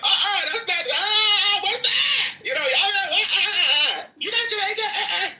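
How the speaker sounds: loud, very high mock-outraged squawk; mocking objectors. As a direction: imitate a crowd shrieking in protest. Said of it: "y'all would be like".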